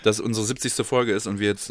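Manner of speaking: ordinary delivery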